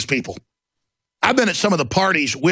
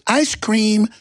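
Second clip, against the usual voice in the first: high, delighted little voice; mock childlike glee; 6 semitones higher than usual. Mocking Buffett holding ice cream with a high, childlike voice. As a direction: coo it like a happy kid. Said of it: "and he goes".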